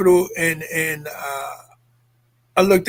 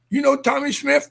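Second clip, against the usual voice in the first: urgent retold questioning; 7 semitones higher than usual. Questioning father.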